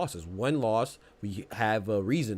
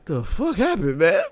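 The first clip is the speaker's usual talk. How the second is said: half-laughing high quote; quoting him while still laughing; 5 semitones higher, 6 dB louder than usual. Retold or imitated. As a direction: giggle the quote out. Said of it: "Tim Tszyu said".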